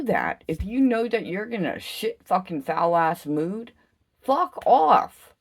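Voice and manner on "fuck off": raised fuck off. Real, not acted. Dismissal, raised voice.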